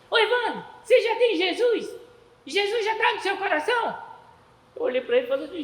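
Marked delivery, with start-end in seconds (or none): 0.1-4.1 put-on high-pitched, loud small-boy voice; Josué Mateini. Small boy questioning his playmate about Jesus, childlike and insistent. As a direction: play an insistent little kid asking. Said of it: "ele era pequenininho".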